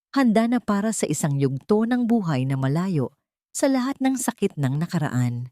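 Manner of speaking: plain reading voice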